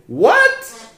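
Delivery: high, loud, incredulous "what"; shocked retort. Retold or imitated. Drunk patient balking at the medic's news, shocked. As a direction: imitate a man stunned in disbelief. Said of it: "he was like".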